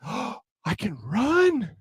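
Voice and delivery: slow, drawn-out mock amazement; gasp, then marvel softly and slowly; stretched-out wonder, acting out the discovery; amazed realization. Amazed he can suddenly run.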